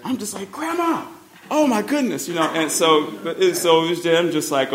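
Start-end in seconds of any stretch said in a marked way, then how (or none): 0.5-2.3 much higher, strained "oh my goodness"; mortified exclamation. Retold or imitated. Grandson watching his grandmother chat with a stranger, mortified. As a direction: squeak it out, mortified.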